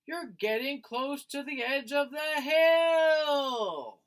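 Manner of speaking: high, loud, slow warning cry; frightened shout of warning; alarmed shout. Acted. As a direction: shout it so he hears you across the snow. Mother warns George near edge.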